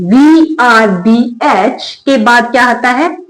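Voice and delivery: slow, letter by letter question; slow spelling question. Shown as a demonstration. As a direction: spell it slowly and ask the class. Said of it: "किसी word में". (धीरे-धीरे अक्षर बोलकर सवाल पूछना)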